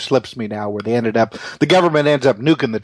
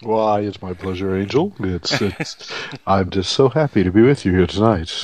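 NPR voice; 4 semitones lower than usual. Pretend. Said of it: "that's my, um, N P R voice".

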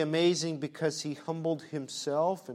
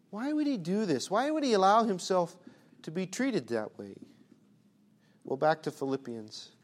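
ordinary talk, nothing marked

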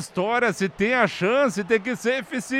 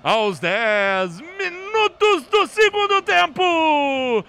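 drawn-out, loud radio slogan; slow signature call; 7 semitones higher, 5 dB louder, much slower than usual. Acted. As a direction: stretch each word like an anthem. Signature sign-off over music. (bordão de rádio arrastado)

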